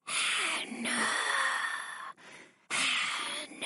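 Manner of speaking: unvoiced ghostly whisper, drawn out; dry whisper. A narrator's reading. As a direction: breathe the name like a haunting shadow. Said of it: "The dry whisper".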